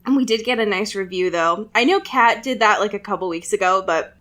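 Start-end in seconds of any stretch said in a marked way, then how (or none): none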